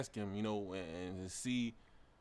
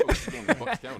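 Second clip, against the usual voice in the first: high, loud laughing repeat; real laugh breaking into a high, loud quote; 13 semitones higher, 10 dB louder than usual. Real, not acted. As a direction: laugh through the question, much higher. Friend laughing repeats the question.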